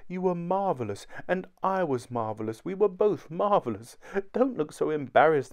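high-pitched gushing wife; shower a husband with grateful praise; adoring wife, elated. A narrator's reading.

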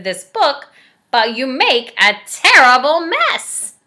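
loud, fed-up monster voice; exasperated Grover. Acted. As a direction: Grover daring the reader to try. Grover exasperated, builds wall.

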